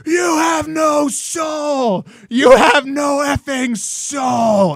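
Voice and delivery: high, very slow furious yell; Mel Gibson. Retold or imitated. Man on tape yelling and cussing, drawn-out shout. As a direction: howl each word in a mock rage. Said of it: "he's yelling and he's cussing".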